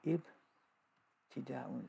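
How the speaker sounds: "ibtidaun" very slow, broken into syllables; sounded out slowly. Shown as a demonstration. Teacher repeating a term while writing, slowly sounded out. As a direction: dwell on each part of "ibtidaun".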